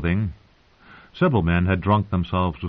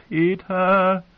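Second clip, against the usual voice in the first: high-pitched, impassioned moan of a name; aunt moaning loudly; 12 semitones higher, 4 dB louder than usual. A narrator's reading. Aunt to her new husband in the marriage bed, high passionate moan. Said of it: "her aunt moaned".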